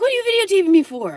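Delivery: high, loud, fast reenacted yell; shouted challenge. Beachgoer confronting dog owner who is filming, reenacted outraged yell. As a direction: fire the question off in a shriek.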